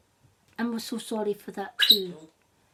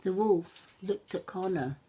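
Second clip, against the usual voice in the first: ordinary delivery, nothing marked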